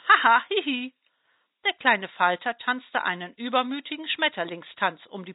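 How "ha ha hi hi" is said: mocking giggle. Butterfly laughs mockingly again.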